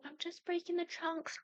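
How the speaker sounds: high, mock-innocent protest; little sister's excuse. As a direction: imitate a kid making an innocent excuse.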